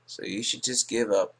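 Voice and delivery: usual speaking voice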